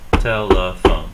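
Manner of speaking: "Telephone" drawn out evenly, beat by beat; syllable by syllable. Shown as a demonstration. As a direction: count out tel-e-phone in "Telephone". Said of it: "how many syllables there".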